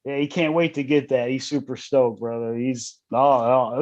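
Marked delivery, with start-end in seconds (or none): none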